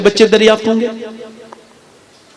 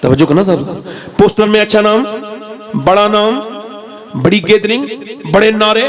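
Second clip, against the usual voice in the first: ordinary delivery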